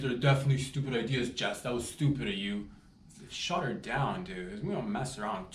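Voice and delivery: ordinary delivery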